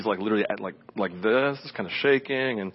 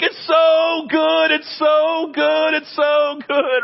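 slow, drawn-out joyful shout, much higher and louder; ecstatic shout; 6 dB louder, much slower than usual. Retold or imitated. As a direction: stretch each word out in pure joy. Woman set free, retold.

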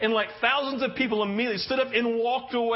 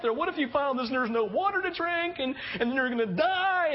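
higher, tumbling fearful questions; frightened daughter to her dad, raised pitch; worried child; 7 semitones higher than usual. Retold or imitated. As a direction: pour out a child's fears. Scared daughter lists fears.

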